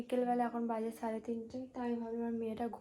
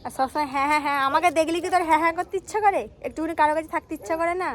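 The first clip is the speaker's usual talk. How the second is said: playful scolding; 5 semitones higher, 13 dB louder, faster than usual. Real, not acted. Mother teasingly scolds clingy daughter.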